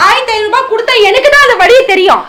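high loud retort; shouting. Acted. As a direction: snap back loudly. Wife retorting loudly.

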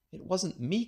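put-on high boyish protest; cried protest. A narrator's reading. Boy protesting to his mother, high indignant cry. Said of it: "cried Nate".

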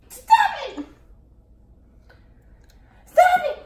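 high laughing shout. Real, not acted. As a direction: yell at her to stop. Friend messing with batter.

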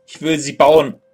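ordinary delivery

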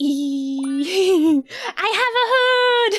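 high, loud gleeful squeal; excited reveal. Real, not acted. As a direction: squeal it out with delight.

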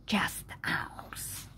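breathy whisper tacked onto the line; just a whisper. Acted. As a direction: drop to a whisper.

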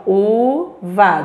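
"uva" drawn out slowly; slow dictation. Shown as a demonstration. Teacher dictates "uva" slowly for pupils to write. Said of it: "vamos escrever aqui".

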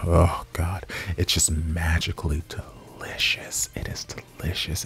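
hushed, savoring whisper; swooning whisper. Real, not acted. Savoring his low voice.

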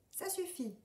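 high, indignant little duck voice; cross duckling cry; fed-up little duck. A narrator's reading. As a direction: stamp your foot as the little duck. Little duck declaring himself done.

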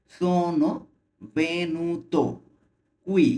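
slow, careful syllable-by-syllable reading; slow teacher demo of Sono venuto qui; slowly. Shown as a demonstration. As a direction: read it slowly for learners. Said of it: "আমরা slowly পড়ি".

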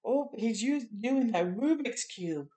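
ordinary talk

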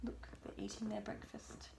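soft whispered aside. Real, not acted. Aside to child while reading.

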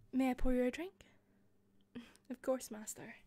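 usual speaking voice

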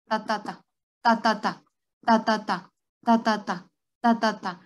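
heart sound imitated in even "ta-ta-ta" triplets; imitated gallop rhythm. Shown as a demonstration. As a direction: tap out a galloping heartbeat with the voice, pausing between triplets. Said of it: "lo que van a escultar es un". (imitando el ritmo de galope cardíaco)

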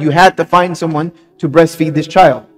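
ordinary delivery

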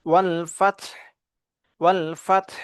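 "ফাতহ" ends on t and breathy h together; careful "ফাতহ" with both final consonants sounded; double sakin ending. Shown as a demonstration. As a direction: close "ফাতহ" with no vowel after the h. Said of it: "তা এবং হায়ের উচ্চারণ একই সাথে".

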